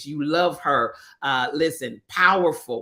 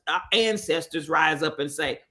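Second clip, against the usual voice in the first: ordinary talk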